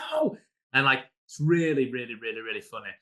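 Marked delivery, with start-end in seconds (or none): 0.0-0.4 much higher, yelped no; a hammed-up cry of horror at spilling treasure; squeaky shocked no; mock dismay. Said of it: "And I'm like".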